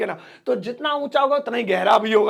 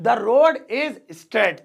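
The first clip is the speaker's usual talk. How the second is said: drawn out word by word; very slow model sentence; 3 dB louder, much slower than usual. Shown as a demonstration. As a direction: dictate it slowly for learners. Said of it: "slow repeated model sentence".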